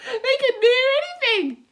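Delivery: high, loud cheeky centipede; brash bug scoffing. Acted. Centipede scoffing at the cloud men, high and loud.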